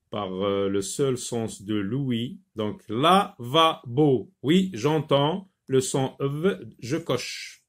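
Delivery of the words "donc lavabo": lavabo said slowly and clearly; slow model word. Shown as a demonstration. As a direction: draw out lavabo for pupils. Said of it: "teacher models the word". (lavabo articulé lentement)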